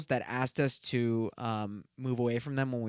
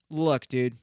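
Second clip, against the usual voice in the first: ordinary delivery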